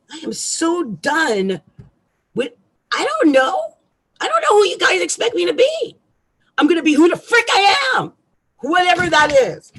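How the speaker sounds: loud, high angry outburst; real anger. Real, not acted. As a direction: shout it, fed up and furious. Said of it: "I'm sorry, I'm so angry".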